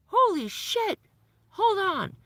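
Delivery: very high, loud frantic exclamation; a doctor on the phone who just saw bad lab results; panicked doctor. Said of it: "But he was so panicked".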